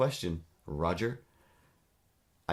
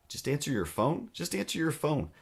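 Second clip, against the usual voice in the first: exasperated; 7 semitones higher than usual. Real, not acted. Exasperated repetition.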